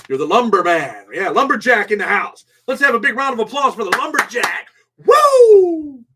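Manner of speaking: loud, high hype-man shout; rising to a big cheer; mock announcer hype. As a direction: whip up the crowd like a ring announcer. Mock announcer hypes donor.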